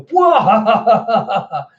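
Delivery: loud, high burst of mock laughter; big performed belly laugh; mock guffaw. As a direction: imitate a crowd cracking up. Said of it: "they all just start laughing at him".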